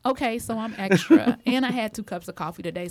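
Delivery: wired, fast and loud; buzzing on two coffees; too much caffeine. Real, not acted. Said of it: "so I am wired right now".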